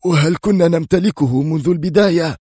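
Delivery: sharp, high-pitched outburst; شامبليون. A narrator's reading. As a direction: fire the question accusingly. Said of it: "هتف بها شامبليون في حدة". (بحدة وانفعال)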